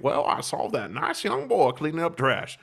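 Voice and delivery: lightly voiced pious stranger, half-amused; imitating prim passerby. Imagined thoughts of passersby.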